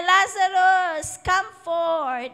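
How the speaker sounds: slow, high, loud cry; commanding Lazarus to come out with a loud cry. Acted. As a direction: summon the dead man from the tomb. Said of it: "he cried with a loud voice".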